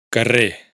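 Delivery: "Carrer" catalán con la erre final muda; sin erre final. Shown as a demonstration. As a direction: di "Carrer" acabando en la e. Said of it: "esta omisión de la R afecta especialmente". (erre final muda en "Carrer")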